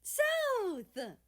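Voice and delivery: high, loud delighted squeal. Acted. Japan squealing on spotting South. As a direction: squeal with joy at a friend. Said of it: "Japan squealed".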